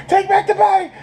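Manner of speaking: high, loud mock panic shout; panicked scream. Reviewer voicing stabbed Steven begging for a switch, high panicked yell. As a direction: scream it like you just got stabbed.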